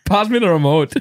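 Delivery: faltering try at a Scottish accent; unconvincing Scottish impression. Shown as a demonstration. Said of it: "You sound not even slightly Scottish".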